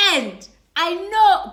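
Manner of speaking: loud and high, slow on know; emphatic, slowed insistence; insistent. Real, not acted. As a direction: press the point hard. Insisting she heard right.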